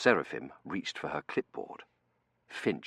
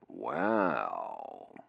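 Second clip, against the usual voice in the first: long-drawn, very low musing; pondering; 12 semitones lower than usual. Acted. Dunworthy absorbing the resignation, slow low pondering. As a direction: stretch it low while thinking.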